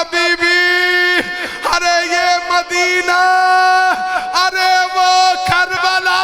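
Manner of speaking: mourning preacher in full lament; majlis lament. Acted. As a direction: cry out the grief, stretching each phrase.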